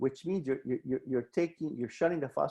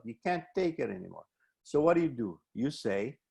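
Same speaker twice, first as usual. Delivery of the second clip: ordinary talk, nothing marked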